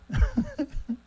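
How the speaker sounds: short high-pitched chuckle; amused little giggle. Real, not acted. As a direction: let out a brief high laugh. Amused by comment-section burning.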